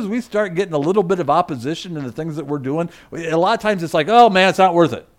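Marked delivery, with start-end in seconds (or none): 4.1-5.0 louder, fed-up giving up; mock exasperation. Preacher voices us facing opposition, mock exasperated. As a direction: toss it out like someone quitting. Voicing people who give up.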